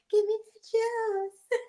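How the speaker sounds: very high slow airy voice with a laugh. Retold or imitated. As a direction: coo it like a breathy pop star. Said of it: "[name]'s dumb, airy voice".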